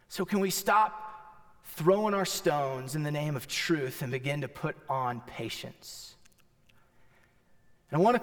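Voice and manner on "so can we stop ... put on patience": softer, lower pleading preacher voice; quiet plea. Acted. Pastor pleading softly with the congregation, gentle and low. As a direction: pull back and gently plead.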